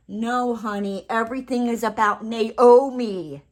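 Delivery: playful me-me-me boast; mock diva. Mocking entitled people, a playful diva boast.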